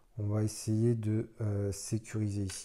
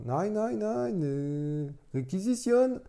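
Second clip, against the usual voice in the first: bad German accent; 10 semitones higher, slower than usual. Mock German soldier again.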